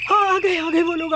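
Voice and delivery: high, urgent heads-up; excited announcement. Acted. Grandmother tells the family the guests have come, excited urgent call.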